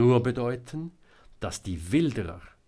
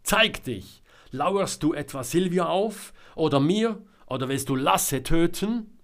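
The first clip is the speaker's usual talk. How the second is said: loud, high accusing questions; shouted challenge; 8 semitones higher, 7 dB louder than usual. A narrator's reading. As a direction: call out an angry demand to someone hidden. Selma challenges hidden Georg. (laut und fordernd rufen)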